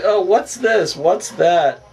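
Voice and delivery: high, bright mock confusion; hammed-up puzzled girls; mock clueless shoppers. Girls baffled at grocery shopping.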